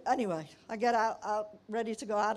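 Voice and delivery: usual speaking voice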